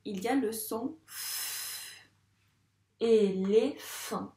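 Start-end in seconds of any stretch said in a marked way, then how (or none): 3.0-4.3 "Éléphant" slow, three detached syllables. Shown as a demonstration. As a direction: stretch "Éléphant" into separate syllables for listening. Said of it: "cocher dans quelle syllabe". (« Éléphant » lentement, syllabe par syllabe)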